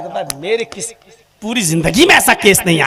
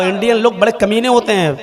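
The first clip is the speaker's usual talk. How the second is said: ordinary talk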